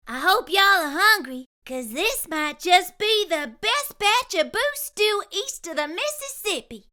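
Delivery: lightly voiced Southern cook, pleased; Curly Locks voice. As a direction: show off your best batch. Curly Locks serves stew.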